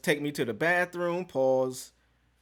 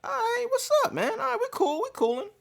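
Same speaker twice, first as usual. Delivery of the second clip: pitched-up breezy self quote; high casual greeting. Retold or imitated. Arriving alone at a new office, greeting the secretary in a high breezy voice. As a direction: act relaxed greeting a stranger.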